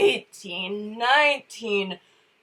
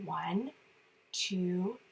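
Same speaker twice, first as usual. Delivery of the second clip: much softer, breathy whisper; 7 dB quieter than usual. Shown as a demonstration. Teaching the kids to whisper, very soft. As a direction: hush it like a secret. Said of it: "can you whisper with me".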